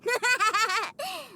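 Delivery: put-on bright victorious child laugh; triumphant giggle. Boy gloating after the guard leaves, triumphant giggle. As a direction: laugh at your win.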